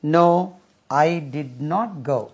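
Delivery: very slow, each word apart; slow classroom model of I did not go; slow model sentence. Shown as a demonstration. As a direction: drill it slowly for the class. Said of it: "नहीं गया।".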